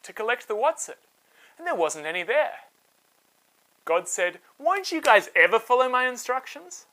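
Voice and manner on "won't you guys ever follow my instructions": exasperated scolding. A narrator's reading. God scolding disobedient Israelites.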